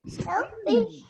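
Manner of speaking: high pleading mouse voice; pleading cry. A narrator's reading. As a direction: beg it in a small high voice. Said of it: "she cried".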